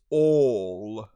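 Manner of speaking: ALL with long, rounded, far-back 'aw'; loud, drawn-out British 'awl' for ALL; British vowel. Shown as a demonstration. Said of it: "in the British I P A".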